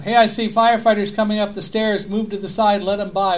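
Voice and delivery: half-strength shout up the stairs; retold shout. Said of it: "David suddenly shouted up".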